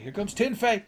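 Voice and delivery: hammed-up wrestling commentator; excited commentator. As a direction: call it like a ringside announcer losing it. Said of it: "He'd be like".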